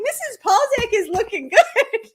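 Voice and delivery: high, loud, laughing fangirl squeal; giggly gushing. Retold or imitated. Teasing the teacher about her photos, laughing. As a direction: giggle it out, half embarrassed.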